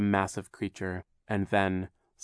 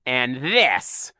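growl; 4 semitones higher, 5 dB louder than usual. A narrator's reading. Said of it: "Count Olaf growled".

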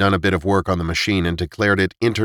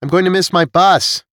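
called back loudly while running off; calling out; 11 semitones higher, 4 dB louder than usual. Acted. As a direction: shout it over your shoulder, running away. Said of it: "she called back over her shoulder".

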